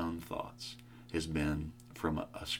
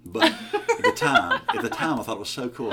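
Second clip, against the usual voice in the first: high, laughing and loud; 12 semitones higher, 13 dB louder than usual. Real, not acted. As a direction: laugh through the memory. Laughing recall of childhood.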